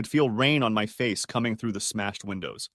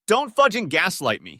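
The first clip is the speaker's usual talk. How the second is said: raised, heated sneer; 8 semitones higher, 4 dB louder than usual. A narrator's reading. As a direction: spit it out with angry contempt. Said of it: "he sneered".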